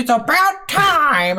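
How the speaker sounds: high, slow, put-upon sigh of a voice; a haughty NPC complaining it took forever; impatient rescued character. Retold or imitated. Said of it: "One of them goes".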